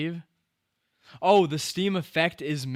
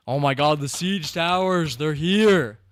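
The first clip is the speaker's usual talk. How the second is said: loud, raised cry of alarm and thrill; excited shouting; 4 semitones higher, 8 dB louder than usual. Real, not acted. Streamer watching the siege towers arrive, excited shouting. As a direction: shout it out, getting louder at the end.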